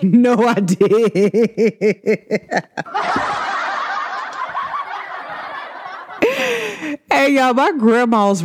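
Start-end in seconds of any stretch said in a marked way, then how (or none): 0.0-2.5 slow, laughing mock grandma. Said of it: "She was like". 2.5-7.1 long high giggle; high laugh. Real, not acted. Laughing at grandma's reply.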